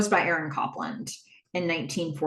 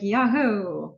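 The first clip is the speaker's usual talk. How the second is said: half-hearted little Yahoo, falling away; not into it. Retold or imitated. Embarrassed Tripp obliging Donna, half-hearted. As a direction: go through the motions of a cheer. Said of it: "Tripp's not really into this".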